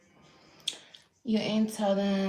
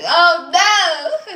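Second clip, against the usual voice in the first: much higher, louder mock protest; mock dismay; 10 semitones higher, 13 dB louder than usual. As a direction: cry out in put-on panic. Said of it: "I'm like".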